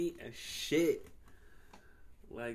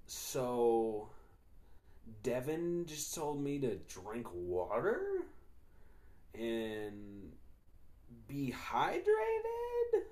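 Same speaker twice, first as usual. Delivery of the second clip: slow, drawn-out mock bewilderment; hammed-up slow disbelief, pitch leaping at the questions; baffled imitation; much slower than usual. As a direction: imitate a puzzled coworker, stretching the words and lifting the questions. Retells coworker's baffled reaction.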